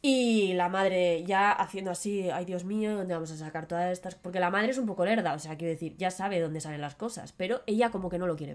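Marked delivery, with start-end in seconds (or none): 2.3-4.2 low, soft, fast fretting; a touch of flustered mother. Retold or imitated. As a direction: worry aloud like a flustered mum.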